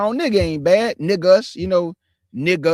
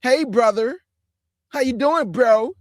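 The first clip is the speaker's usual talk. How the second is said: higher, put-on chummy bro voice; mock an over-friendly "brother" greeting, pitched up; 6 semitones higher, 4 dB louder, slower than usual.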